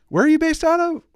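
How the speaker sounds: high, loud probing questions; suspicious crew questioning. Retold or imitated. Crew questioning the fake pilot, high and probing. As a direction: interrogate him like wary cabin crew. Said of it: "Or like".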